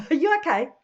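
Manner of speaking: lightly voiced Yorkshire greeting. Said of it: "they immediately say to you".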